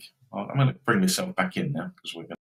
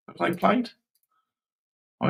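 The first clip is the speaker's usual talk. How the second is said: ordinary talk, nothing marked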